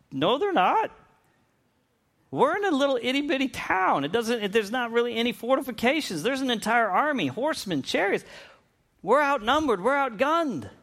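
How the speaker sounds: high, incredulous protest of a worried servant; doubting servant. Retold or imitated. Servant protests to Elisha about the army, high incredulous voice. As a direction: push back in disbelief, pitch up. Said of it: "scratching his head going".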